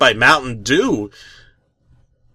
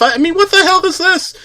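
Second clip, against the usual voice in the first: much higher, fast disbelief; can't believe what you're watching; exasperated high outburst; incredulous; faster than usual. Real, not acted. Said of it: "getting ready to shut the tape off".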